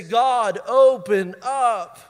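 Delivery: loud, drawn-out appeal to God; calling out in prayer. Shown as a demonstration. Preacher showing the surrounded how to pray, loud and drawn out. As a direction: cry out slowly and forcefully.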